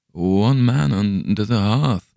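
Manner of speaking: slow, strange, hollow telling; unnatural tone. A narrator's reading. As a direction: speak as if in a trance. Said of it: "in the same unnatural tone".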